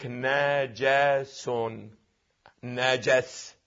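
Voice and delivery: "نجس" as na-ja-sun, syllable by syllable, slow and low; fatha on jim. Shown as a demonstration. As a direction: spell out "نجس" softly with an a after n and j. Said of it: "والحركات الثلاث في الجيم". («نجس» بفتح النون والجيم، ببطء)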